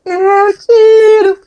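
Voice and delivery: put-on very high, loud and slowly drawn out like a sulky child; child's answer. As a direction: drag the words out like a pouting kid. Daughter answers in story. (filha emburrada respondendo, bem aguda e arrastada)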